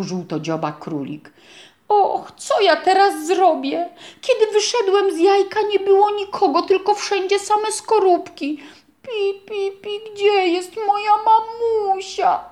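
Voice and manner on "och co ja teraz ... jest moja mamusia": high, tearful chick voice; whimpering chick. A narrator's reading. Lost chick asking the rabbit for mama, high and tearful. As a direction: squeak it close to tears. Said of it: "zaczął chlipać kurczaczek".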